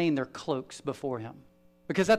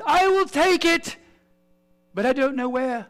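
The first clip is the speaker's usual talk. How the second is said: Frodo's line; 11 semitones higher, 5 dB louder than usual. Retold or imitated. Frodo offering to take the ring, high and hesitant. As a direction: pitch it up like a small frightened hobbit. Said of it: "Frodo ... says".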